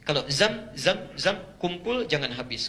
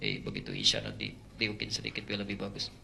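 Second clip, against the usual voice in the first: low, soft tempting murmur; devil's whisper; 7 semitones lower, 11 dB quieter than usual. Retold or imitated. Voice of Satan tempting the imam, soft and low. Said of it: "Masuk setan".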